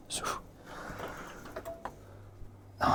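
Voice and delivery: normal talking voice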